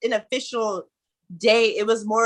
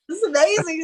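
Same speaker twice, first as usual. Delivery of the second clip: amazed excitement; 10 semitones higher than usual. Retold or imitated. Retelling his own awe at the party, high excited squeal. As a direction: gush like a wide-eyed fan. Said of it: "I was just like".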